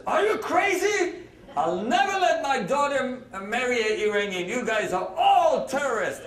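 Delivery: high-pitched, indignant mock outburst; outraged father-in-law. Father-in-law to his daughter's Iranian suitor, mock outrage. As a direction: play a scandalized father refusing the match.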